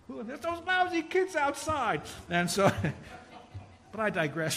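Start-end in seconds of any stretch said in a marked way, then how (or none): 0.1-0.3 mock puzzled, higher voices; confused bingo players. 0.3-2.0 much higher, slow mock grumble; annoyed churchgoer spotting the pranksters, higher and slower; grumpy old neighbor. Grumpy adult caught the kids.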